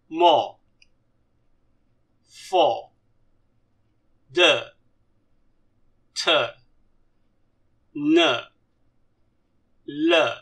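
each syllable alone with a falling tone; pronunciation demo. Shown as a demonstration. Teacher models consonants for learners, isolated falling syllables. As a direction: recite the consonants one by one, long pauses between. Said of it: "we go through this pronunciation".

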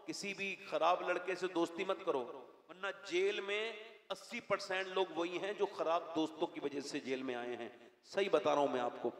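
ordinary talk, nothing marked

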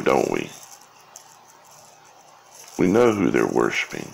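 ordinary talk

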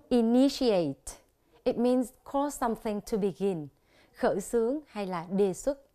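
normal talking voice

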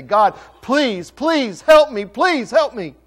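high, loud pleading; desperate cry to God. Acted. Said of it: "we cry out desperate".

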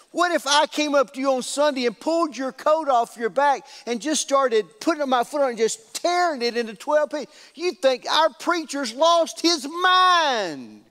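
high-pitched mock outrage; hammed-up appeal to the crowd; incredulous indignation. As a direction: build to a long, high, exasperated finish. Mock outrage put to congregation.

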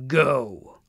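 roared command without much volume; narrated roar, held back; understated roar. A narrator's reading. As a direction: let GO ring out as a restrained roar. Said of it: "uttering the last word in a great roar".

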